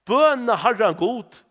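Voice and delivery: slow, loud and weighty, each word drawn out; a drawn-out beg to the prophet; slow emphatic plea. Retold or imitated. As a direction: lean hard on Bøna. Preacher quotes the king's plea.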